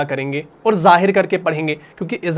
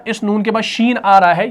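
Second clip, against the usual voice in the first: ordinary talk, nothing marked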